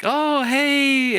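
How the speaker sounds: high, bright greeting of a friendly lady at the door; mock friendly neighbor greeting; sing-song greeting. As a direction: greet the kids in a high singsong. Ladies greeting trick-or-treaters.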